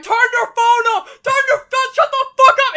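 high, loud mock crowd yelling. Retelling a sound clip, loud high crowd yell. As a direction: shout it up high like an angry cinema crowd.

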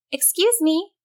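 high-pitched, polite call. A narrator's reading. Susan calls to the troll under the bridge, high polite call. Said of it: "called out to the creature".

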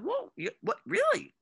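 retold shock that she paid; surprised. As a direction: squeak it out in shock. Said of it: "I said".

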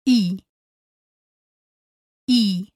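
pure ee vowel, said twice; crisp clean ee; Spanish i sound. Shown as a demonstration. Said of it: "always sounds like this".

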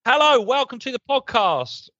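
high, bright show welcome; excited greeting. Acted.

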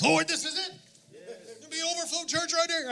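high, eager pleading to the Lord; excited prayer. Retold or imitated. Preacher retells praying over the building, high eager plea. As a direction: pray it high and hopeful, trailing off soft.

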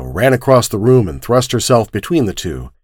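ordinary delivery, nothing marked

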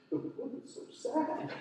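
breathy tearful squeak, half-acted; mock sobbing. Pastor retelling his tears to the church, mock sobbing. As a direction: replay the crying dad, airy and high.

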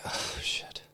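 whispered, sinking reaction; retold stunned whisper; hushed dread. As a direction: let it out under the breath in a whisper. Retelling his shocked reaction at ultrasound.

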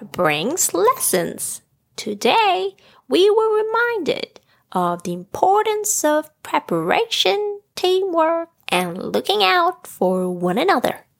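high, swooping character voice giving a lesson; storybook character. Acted. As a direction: swoop up high, sharing the moral. Story figure voiced beside narration.

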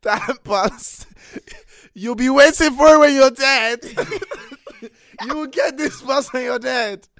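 laughing while joking loudly; half-laughing playful jab at the bus; laughing mock curse. Real, not acted. Mocking the bus that never comes.

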